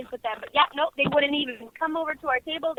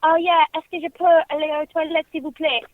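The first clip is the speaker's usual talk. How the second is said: louder showy French phrase; French phrase, showing off; 3 semitones higher, 6 dB louder than usual. Retold or imitated. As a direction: rattle off French like it's fluent. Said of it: "I'm like".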